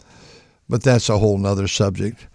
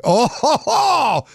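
pained howl; 14 semitones higher, slower than usual. Retold or imitated. Patient in pain, third day.

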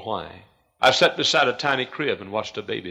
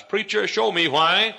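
crying out; 10 semitones higher, 8 dB louder, slower than usual. A narrator's reading. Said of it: "lonely voice cries".

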